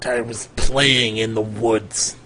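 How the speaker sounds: slow, low, sarcastic wizard. Acted. Captive wizard answers his questioner, low and sarcastic. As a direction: draw it out with dry sarcasm. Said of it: "he says, uh, sarcastically".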